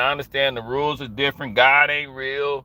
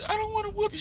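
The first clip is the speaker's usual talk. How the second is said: crying mama; 9 dB quieter, faster than usual. Retold or imitated.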